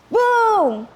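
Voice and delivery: a high, loud "Boom!" for lightning; booming sound effect. A narrator's reading. Narrator booms out the lightning crash. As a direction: boom out the thunderclap.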